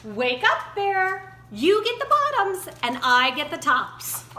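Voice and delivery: cheerful shout to wake the bear; calling out. A narrator's reading. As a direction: call out across the field to rouse him. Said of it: "Hare called out".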